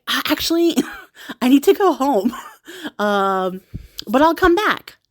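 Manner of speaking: a giggly prince backing out; sheepish prince. Retold or imitated. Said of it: "the prince is like".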